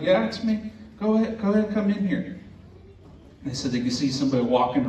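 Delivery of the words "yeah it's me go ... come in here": lightly acted, high-pitched answer; raised voice-pitch, no added volume; retold calling out. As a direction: imitate a call through the door, pitched up. Acted exchange at the open door.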